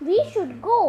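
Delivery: ordinary delivery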